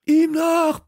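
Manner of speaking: high, loud shout; roaring. Acted. Kalle roars at his friends to chase Hasso, loud shout. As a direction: bellow the chase order. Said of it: "brüllte Kalle".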